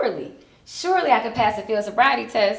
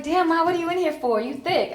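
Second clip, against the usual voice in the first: high-pitched impression of a cellmate; lightly mocked high woman's voice; 9 semitones higher than usual. And she's like.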